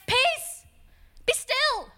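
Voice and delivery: high, sharp teacherly order; scolding command. Acted. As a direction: call it out like telling off a child. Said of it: "Like he's talking to an unruly".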